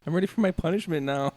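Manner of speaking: high, quick mock child voice; naughty kid. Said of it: "your kid starts acting bad".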